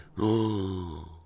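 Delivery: loud, hammed-up gasp; big mock gasp, imitating his overreaction; imitated shocked gasp. Retelling a classmate's reaction to his phone, exaggerated gasp. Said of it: "like, the biggest thing had ever occurred".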